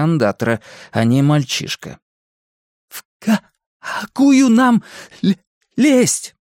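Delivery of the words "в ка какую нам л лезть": slow, high stammer, sticking on first sounds; stuttering question. A narrator's reading. Boy asks Eddie which pipe to climb, stuttering. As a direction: push each word out through a stutter.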